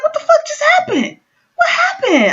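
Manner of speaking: very high, bewildered squeal; retold high-pitched shock; shocked disbelief. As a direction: squeak it out, totally baffled. Acted shock at seeing the fall.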